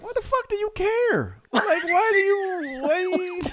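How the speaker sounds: high, incredulous what-do-you-care; irritated reply to a nosy stranger, pitched high; exasperated. Retold or imitated. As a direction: throw up your hands in disbelief. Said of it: "I was like".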